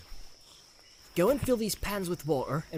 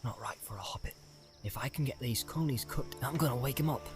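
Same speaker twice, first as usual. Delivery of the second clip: hushed mutter, near whisper at first; soft rustic hobbit fretting; 7 dB quieter than usual. A narrator's reading. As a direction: mutter to yourself, then firm up a little. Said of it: "he muttered".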